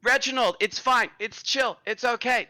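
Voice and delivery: high, loud surfer-dude voice; a laid-back bro telling someone to calm down; chill bro cleric. Acted. Bro cleric soothes the old man.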